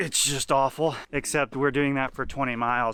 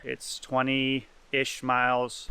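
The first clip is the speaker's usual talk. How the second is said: ordinary talk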